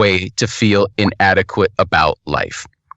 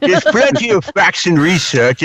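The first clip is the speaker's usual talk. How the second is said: higher put-on voice, mouth stopped by a cork; 7 semitones higher than usual. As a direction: speak round an imaginary cork. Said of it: "talk like this for the rest".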